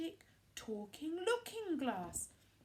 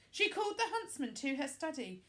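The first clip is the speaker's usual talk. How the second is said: ordinary delivery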